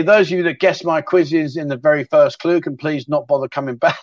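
usual speaking voice

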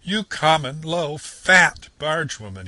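high, loud, slow scornful shout; shouting insult. A narrator's reading. Toad insulting the barge woman, furious shout. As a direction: spit each insult out. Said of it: "he shouted".

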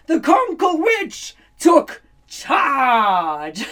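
triumphant. A narrator's reading. Triumphant turn in story.

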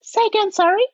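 high-pitched mock request to repeat; mimicking puzzled listeners. Imitating people who could not follow her accent, high mimicry. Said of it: "I just got irritated with".

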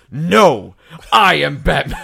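loud, forceful hero declaration; intense Batman shout. Retold or imitated. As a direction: imitate Batman bursting in at full intensity. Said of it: "he came in at an eleven".

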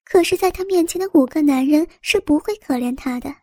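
ordinary delivery, nothing marked

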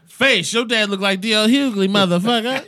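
high, loud mocking roast. Real, not acted. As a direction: shout the insult with glee. Roasting friend's dad.

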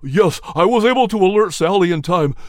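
hurried, high and forceful; hurried explaining. A narrator's reading. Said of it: "Nelson explained hurriedly".

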